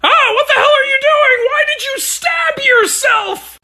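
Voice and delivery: high, loud alarmed yelling; shocked high-pitched yelling; horrified shout. Acted. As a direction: scream in shock at the wound. Shocked at self-stabbing.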